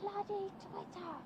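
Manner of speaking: soft, high Scottish accent. Shown as a demonstration. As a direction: say it like a Scot, lightly. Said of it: "But, like, in a Scottish accent".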